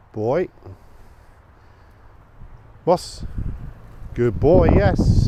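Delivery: high, loud doggy praise; praising the dog. Real, not acted. Praising his dog Moss.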